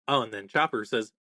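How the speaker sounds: normal talking voice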